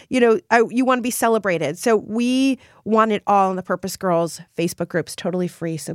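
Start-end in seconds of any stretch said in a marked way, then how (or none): none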